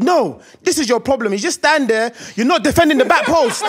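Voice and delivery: scolding. Retold or imitated. Dad scolding son.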